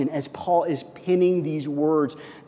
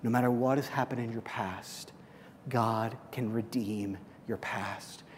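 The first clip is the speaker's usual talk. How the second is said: much softer, low and close; hushed intimate preaching; 4 semitones lower, 7 dB quieter than usual. Acted. Pastor pleading with the congregation, hushed. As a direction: lean in and confide it to the room.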